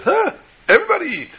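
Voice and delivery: very high, far-off guest voice calling everyone; cheerful guest. Acted. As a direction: squeal it out like a jolly stranger. Guests urge everyone to eat.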